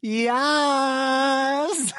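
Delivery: slow, high, drawn-out intro; podcast intro. Acted. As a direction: stretch each word like a show opener. Host's drawn-out show intro.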